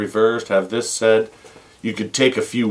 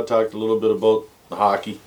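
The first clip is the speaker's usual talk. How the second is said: usual speaking voice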